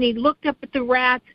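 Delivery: normal talking voice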